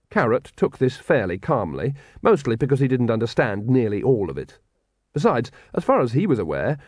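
usual speaking voice

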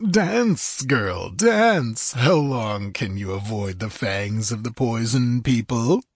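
cruel taunts, pitch swooping up on key words; gloating villain. Acted. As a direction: savour the threat, drawing it out.

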